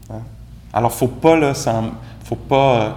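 ordinary delivery

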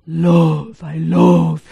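imitating a Tibetan accent; gentle Tibetan-accented English. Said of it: "in his Tibetan accent".

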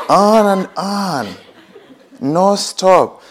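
high, stretched-out words for endlessness; drawn-out and relentless. Acted. Teacher showing retreatants the mind that keeps adding on, drawn-out and relentless. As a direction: draw it out high, as if it never ends.

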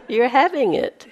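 ordinary delivery

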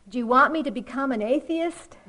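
loud mock outrage; Calvin yelling skyward. Cartoon kid yelling at the heavens in the last frame, mock outrage. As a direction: hurl the question up as a pretend threat. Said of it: "yelling up again at the heavens".

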